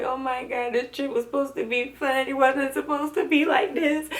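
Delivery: high, soft, tearful mock lament; imitating crying. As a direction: whimper it like you're back in tears. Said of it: "So I was sitting there crying".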